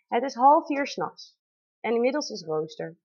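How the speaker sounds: ordinary delivery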